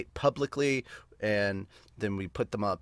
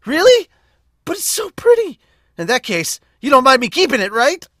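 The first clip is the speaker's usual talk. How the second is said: put-on very high, loud, whiny girl voice; squeaky high pouting girl; pleading anime girl; 15 dB louder than usual. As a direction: beg to keep the pretty trinket. Girl character wants to keep item.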